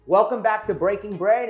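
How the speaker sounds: usual speaking voice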